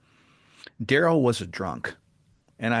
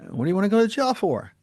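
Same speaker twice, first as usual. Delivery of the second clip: puzzled question; 8 semitones higher, faster than usual. Retold or imitated. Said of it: "I said".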